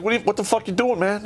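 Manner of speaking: high, startled what-are-you-doing; mock baffled challenge; retold surprise at the buffing; incredulous question. Narrator confronting buffing sergeant.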